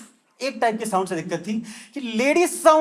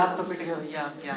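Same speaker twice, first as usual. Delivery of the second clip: much softer, lower resigned mutter; defeated; 6 semitones lower, 10 dB quieter than usual. Acted. Beaten man thinks he is finished, softly resigned. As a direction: give up under your breath.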